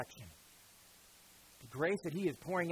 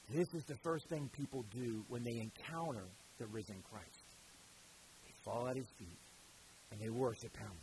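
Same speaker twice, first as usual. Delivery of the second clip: reverent hush; 7 semitones lower, 10 dB quieter than usual. Real, not acted. Preacher drops to reverent hush.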